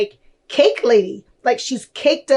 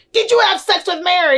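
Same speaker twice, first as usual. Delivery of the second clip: higher, fast mock mom grilling her son; prying mom; 7 semitones higher, faster than usual. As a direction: blurt it out like a nosy mother. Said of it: "she's like".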